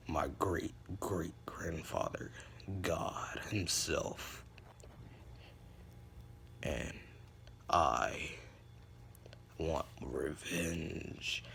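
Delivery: very low, drawn-out dramatic character voice; slow, hushed, ominous vow. Acted. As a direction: intone it deep, with long pauses. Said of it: "his voice got deeper".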